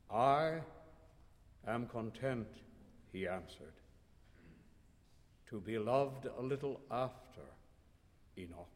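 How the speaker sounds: low, soft, resigned; a hint of a resigned man; quiet resigned Philip. A narrator's reading. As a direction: settle for little, quietly.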